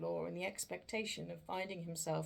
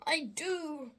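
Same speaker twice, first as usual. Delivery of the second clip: frightened answer; 10 semitones higher, 5 dB louder than usual. Acted. Scrooge answers the ghost.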